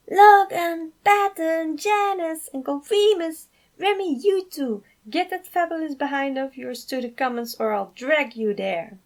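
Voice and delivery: high, loud calling out of names; flamboyant yell. Acted. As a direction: summon the whole family at full voice. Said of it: "he yelled".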